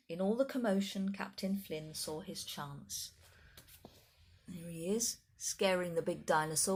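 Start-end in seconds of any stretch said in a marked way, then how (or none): none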